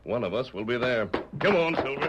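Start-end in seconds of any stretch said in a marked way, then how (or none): 1.4-2.1 raised, higher call to the horse. Acted. Masked rider calling his horse Silver to ride, raised call.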